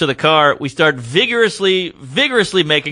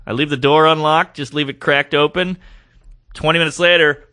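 normal talking voice

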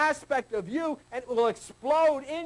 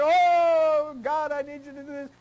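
mock wailing prayer; 6 semitones higher, 5 dB louder than usual. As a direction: ham up needy praying. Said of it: "There's Albert".

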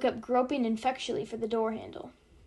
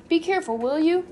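higher, louder urgent warning; cautioning; 6 semitones higher, 7 dB louder than usual. A narrator's reading. Nately to the reckless driver, cautioning. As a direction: warn the driver sharply. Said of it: "Nately cautioned".

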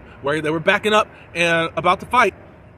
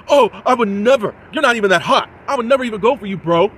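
mock indignant denial; 5 semitones higher, 6 dB louder than usual. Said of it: "And then you're saying".

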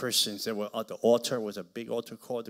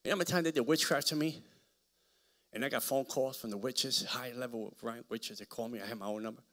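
normal talking voice

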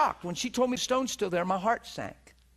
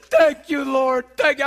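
loud, elated praise to God; loud happy praise; joyful thanks; 5 semitones higher, 10 dB louder than usual. Retold or imitated. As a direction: burst out thanking the Lord. Thanking God after reprieve.

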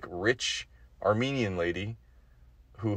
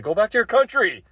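much higher and louder yell; mock shout; 13 semitones higher, 8 dB louder than usual. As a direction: explode into the punchline. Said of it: "I'm like".

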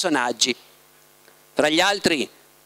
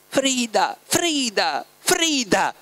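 grido supplichevole; 7 semitones higher, much slower than usual. A narrator's reading. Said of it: "le gridò nell'orecchio una voce insistente, supplichevole".

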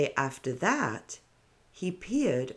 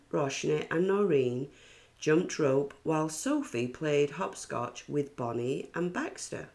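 ordinary delivery, nothing marked

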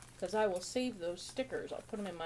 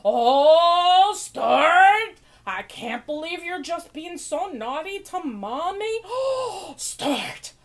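loud, high mock-scolding mother with gasps; scandalized TV mommy; 7 semitones higher, 11 dB louder, slower than usual. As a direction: gasp and wail at your naughty child. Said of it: "And she'd be like".

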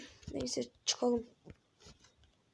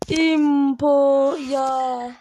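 slow whining plea; drawn-out whine; 7 dB louder, much slower than usual. Real, not acted. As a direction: stretch it very slow and loud. Dragged out pleading.

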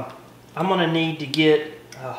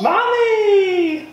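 excited exclamation. Real, not acted. Excited echo to child.

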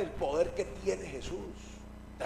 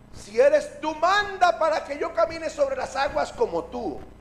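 loud, high, insistent call; 6 semitones higher, 7 dB louder than usual. Acted. Pedro asks Jesus to let him walk on water, loud calling out. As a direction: project the request over the waves.

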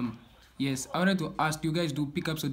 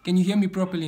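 louder for a bad line; to someone hard of hearing; 4 semitones higher, 8 dB louder, faster than usual. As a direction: speak up so they hear. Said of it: "You are breaking up".

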